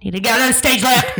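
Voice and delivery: a loud, fast, blaring half-shout meant as a whisper; a failed whisper that booms; whisper turned shout. Retold or imitated. Stage manager blasting instructions into an actor's ear. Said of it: "she could not whisper".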